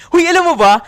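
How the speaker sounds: loud, high excited hey; excited call-out. Real, not acted. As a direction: grab the viewers with a loud shout. Excited opener to viewers. (excited na sigaw)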